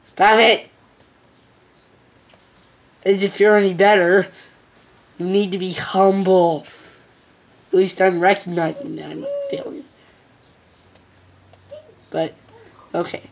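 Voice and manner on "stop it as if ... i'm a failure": loud, playful telling-off; loud protest. Real, not acted. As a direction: shout back at her teasingly. Protesting at laughing mom.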